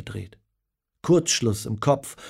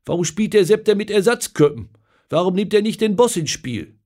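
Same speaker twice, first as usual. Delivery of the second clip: loud, higher, exasperated complaint; upset outburst; 9 semitones higher, 7 dB louder than usual. Acted. Paul at the pub watching Germany lose, loud upset. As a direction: vent at the screen like a let-down fan. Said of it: "Paul regt sich auf".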